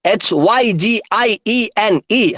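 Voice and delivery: letters strung together evenly; speedy spelling. Shown as a demonstration. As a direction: run through the spelling without stopping. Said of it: "ഒന്നുകൂടെ സ്പീഡില്".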